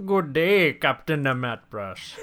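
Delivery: a slow, hammed-up pseudo-German greeting; mock German villain accent. Co-host greets the host in mock Red Skull accent. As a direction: put on a fake villain accent, slowly. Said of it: "That's not a Red Skull accent at all".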